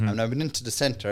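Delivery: ordinary talk, nothing marked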